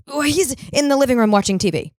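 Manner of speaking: lightly voiced mother answering; mom voice. Daughter quoting mom on the phone, lightly voiced mother.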